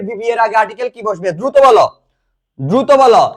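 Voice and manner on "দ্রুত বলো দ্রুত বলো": loud, pressing call for answers; urgent prompting. Real, not acted. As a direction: push the class to answer, loudly. Teacher urges class to answer quickly.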